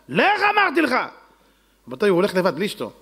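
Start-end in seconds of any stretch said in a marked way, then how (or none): 0.1-0.9 insisting rich man. Acted.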